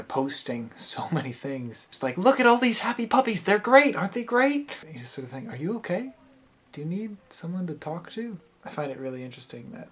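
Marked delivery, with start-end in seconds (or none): 2.2-4.8 high-pitched, fast gushing; manic fake cheer. Mocking someone's over-cheerful posts. 5.5-8.4 high, soft, tentative asking; gentle concern. Acted. Acts concerned reply to poster.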